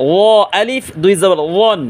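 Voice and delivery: ও as a heavy, dark, rounded voiced th; thick ও. Shown as a demonstration. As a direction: fill the mouth for ও and ওয়ান. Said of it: "আর এটা মোটা আওয়াজে".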